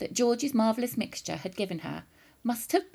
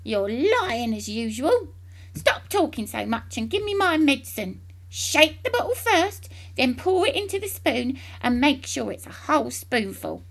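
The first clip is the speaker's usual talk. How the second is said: higher, louder crabby commands; louder bossy old-woman voice; bossy grandma; 5 semitones higher, 3 dB louder than usual. A narrator's reading. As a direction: order him about like a crone. Grandma orders her medicine.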